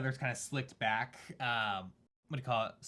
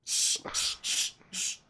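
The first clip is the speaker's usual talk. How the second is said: sharp repeated voiceless hushes; urgent shushing; 3 dB louder, slower than usual. Acted. Lookout silencing a loud caster, urgent hush. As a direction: hush them quickly, over and over.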